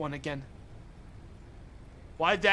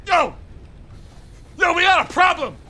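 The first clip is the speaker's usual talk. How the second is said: yelling much higher and louder; alarmed shout; 9 semitones higher, 10 dB louder than usual. Real, not acted. Streamer alerting chat to a problem, shouting in alarm. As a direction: sound the alarm at the top of your voice.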